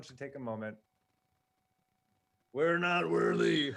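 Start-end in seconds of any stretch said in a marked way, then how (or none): 2.5-3.7 loud, slow, drawn-out chant; bow down in mock worship. Quoting famous bowing line.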